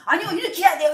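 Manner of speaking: high, loud mock protest; insistent objection. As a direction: imitate someone stubbornly pushing their own view. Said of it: "자기 말이 막 옳다고". (자기가 옳다고 우기는 흉내)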